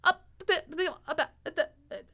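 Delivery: high, flustered, half-laughing sputter; flustered stammer. Retold or imitated. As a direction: sputter helplessly, half crying. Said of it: "I'm like".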